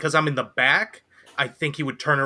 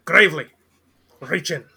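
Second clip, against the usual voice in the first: higher, urgent call to a companion; calling out in character; 5 semitones higher, 8 dB louder than usual. Acted. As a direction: call over to a teammate, loud at first.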